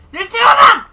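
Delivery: screaming in high, loud alarm; panicked shout. Real, not acted. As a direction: yell in sheer panic. More enemies appear.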